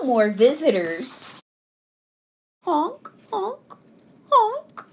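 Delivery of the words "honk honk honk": high imitated goose honks. A narrator's reading. Geese still in Bruce's house, high honks. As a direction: honk high, in separate little bursts. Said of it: "said the geese".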